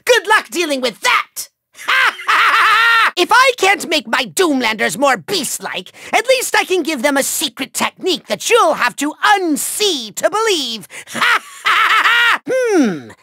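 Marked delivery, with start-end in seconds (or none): 1.8-3.1 long, high villain cackle; evil cackle. Acted. Villain gloats over a trick up his sleeve, maniacal laugh. 11.1-12.4 long, high villain cackle; evil cackle. Acted. Villain gloats over a trick up his sleeve, maniacal laugh.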